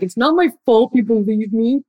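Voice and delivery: lightly mock a sulky child protesting; defensive child self. Coach mocking a listener's childish protest, defensive kid. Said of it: "get out of your child self".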